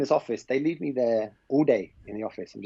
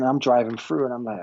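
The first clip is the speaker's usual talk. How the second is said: normal talking voice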